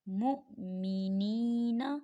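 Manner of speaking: मुमिनीना recited slowly, मीम joined to हमज़ा, वाव silent; slow recitation voice for मुमिनीना; Quranic recitation. Shown as a demonstration. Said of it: "कैसे पढ़ेंगे?".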